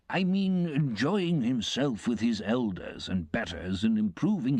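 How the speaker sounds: pompous guest relishing a gruesome warning; pompous uncle. Acted. Pumblechook lecturing the boy.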